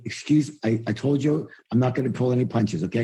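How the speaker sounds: normal talking voice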